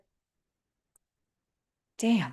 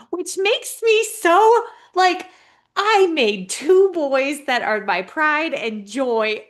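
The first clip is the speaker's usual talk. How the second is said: excited gush; 8 semitones higher, 12 dB louder, slower than usual. Real, not acted. Panelist bragging about her baby SLPs, excited high gush. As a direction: voice jumping much higher and louder, slowing down with pride.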